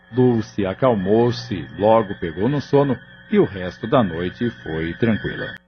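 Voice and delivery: usual speaking voice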